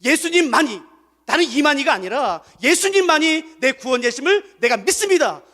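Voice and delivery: loud, high declaration of faith; confession said aloud. Shown as a demonstration. Pastor leading the church's confession, loud and firm. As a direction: proclaim it boldly. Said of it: "성도들 앞에서 고백하세요".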